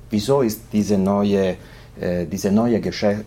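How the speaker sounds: ordinary delivery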